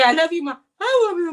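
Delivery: high, loud coaxing "I love you"; sing-song baby talk to the dog; high pet-talk coaxing. Shown as a demonstration. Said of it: "Oh, say".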